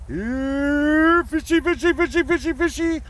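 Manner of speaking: high, loud singsong call; Muppet calling fish. Retold or imitated. Ernie calling fish into the boat, high loud singsong. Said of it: "And he goes".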